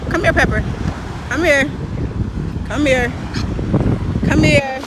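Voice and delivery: loud dog-calling. Real, not acted. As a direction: call the dog back firmly.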